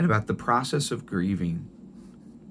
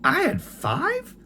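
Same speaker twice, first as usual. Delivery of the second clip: very high, slow disbelieving question; a grandmother who forgot her own children; astonished grandma; slower than usual. Retold or imitated. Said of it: "And she said".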